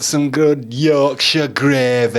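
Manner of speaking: slow, loud Yorkshire impression; Yorkshire accent. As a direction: stretch it out in broad Yorkshire. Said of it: "That's what he said to me once".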